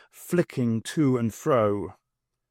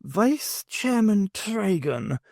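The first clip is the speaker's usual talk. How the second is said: displeased surprise; 8 semitones higher, much slower than usual. A narrator's reading. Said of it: "in displeased surprise".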